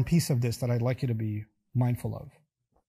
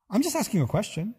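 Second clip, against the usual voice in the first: mock innocent; 6 semitones higher than usual. Mimicking feigned innocence.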